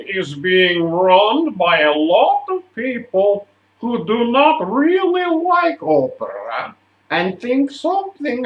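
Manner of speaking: put-on slow, high, loud-starting Russian accent; thick Russian accent. Touring company head confides in young singer, thick Russian accent. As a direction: declaim it slowly in a heavy Russian accent. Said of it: "in his thick Russian accent".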